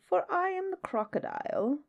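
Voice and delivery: a hint of crocodile, soft and rising high. A narrator's reading. Crocodile reveals himself to the child, softly. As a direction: reveal it softly, lifting the pitch. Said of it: "said the crocodile".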